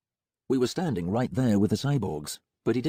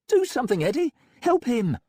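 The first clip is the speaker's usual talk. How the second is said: high, louder, urgent cry; frantic plea for help; 4 dB louder than usual. A narrator's reading. Molly urges in panic.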